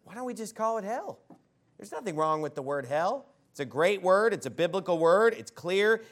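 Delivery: ordinary talk, nothing marked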